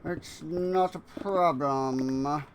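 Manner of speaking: a hint of a smug cartoon villain musing; Fat Cat villain voice. Gamer musing at his build in a mild cartoon villain voice. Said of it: "no idea what that accent is supposed to be".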